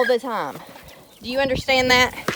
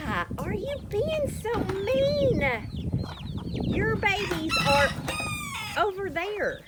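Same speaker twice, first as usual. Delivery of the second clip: high pet voice; 13 semitones higher than usual. Real, not acted. Baby talk to goat.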